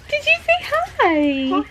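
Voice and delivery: high, slow sing-song question. Real, not acted. Mother asking her baby to say hi, slow high coo.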